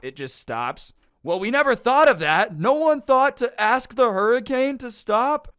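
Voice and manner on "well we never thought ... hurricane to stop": much higher, louder, cartoon-astonished voice; goofy high shocked voice; mock amazement. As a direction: ham up dumbfounded wonder. Mock amazed people in joke.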